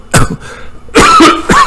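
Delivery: a sudden real cough that catches the throat; coughing fit. Real, not acted. Musing on life's meaning, he chokes and coughs. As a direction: cough hard, choking on the words mid-sentence. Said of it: "I'm getting choked up".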